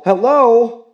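loud high hail from a distance; calling out. Acted. Girl calling to the neighbors from the street, loud hail. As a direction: call across the street. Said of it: "a voice called".

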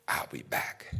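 hushed, low whisper; whispered threat from Death; Death's whisper. Acted. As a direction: breathe it low and menacing. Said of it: "See, just like that".

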